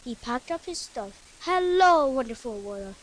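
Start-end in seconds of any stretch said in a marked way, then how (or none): 1.4-3.0 excited. A narrator's reading. Boy greeting the world excitedly on his trip. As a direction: greet the world with a big happy cry. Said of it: "Mike said excitedly".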